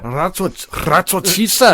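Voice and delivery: high, badly faked Scottish accent through laughter; attempted Scottish accent. Imagining the Scottish host doing the joke, badly faked Scottish accent. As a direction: try the catchphrase in a hammed-up Scottish accent. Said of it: "I wish I could do accents".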